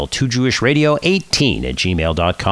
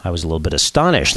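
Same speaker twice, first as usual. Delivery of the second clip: ordinary delivery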